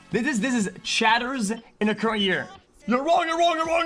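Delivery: ordinary talk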